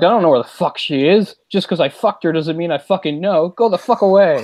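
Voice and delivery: lightly hammed-up sweary dismissal; mock angry ex-boyfriend. Acted profane ex-boyfriend from movie scene.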